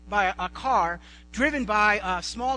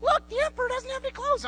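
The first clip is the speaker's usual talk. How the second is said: lightly voiced child, pitched up; little boy; 14 semitones higher than usual. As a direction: chirp it like a small boy. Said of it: "the little boy that says".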